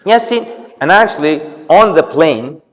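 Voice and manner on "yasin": mock calling out, much higher pitch; nervous mother calling her son Yasin, imitated high; mother calling child. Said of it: "she just didn't stop".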